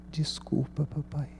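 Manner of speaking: soft imitation of a weeping daughter; imitated tearful apology. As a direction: gently voice a child's tearful sorry. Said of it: "Ela começou a chorar".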